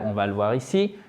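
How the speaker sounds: usual speaking voice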